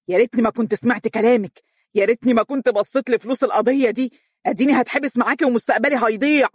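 frantic shout of blame. Acted. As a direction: shout out bitter regret. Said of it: "وهو يصيح".

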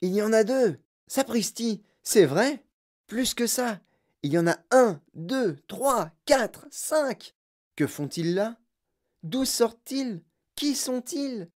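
high, astonished exclamations; excited cries. A narrator's reading. Children and parents spot the little men, excited cries. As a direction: cry out one after another in amazement. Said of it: "se mit à pousser des cris".

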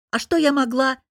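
high, loud defensive attack; attacking retort. A narrator's reading. Friend snapping back at Lera in defence. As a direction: hit back hard. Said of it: "напала в ответ подруга".

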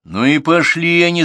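loud, high angry yell. A narrator's reading. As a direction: bellow it in a temper. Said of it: "заорал Артур".

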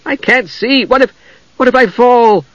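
high frightened voice; fearful, faltering plea; shaking voice. A narrator's reading. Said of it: "her voice shaking".

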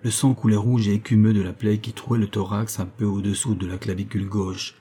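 usual speaking voice

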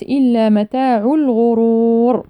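slow chanted إلا with drawn-out vowels to الغرور; separated elongation. Shown as a demonstration. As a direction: stretch the vowels of إلا متاع الغرور in recitation. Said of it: "مد منفصل أربع أو خمس حركات". (ترتيل مع إطالة المد في إلا متاع الغرور)

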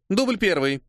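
put-on high-pitched clapperboard girl; announcing. A narrator's reading. Girl with clapperboard announcing the take, announcing. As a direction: call the take number. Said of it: "объявила девушка с хлопушкой".

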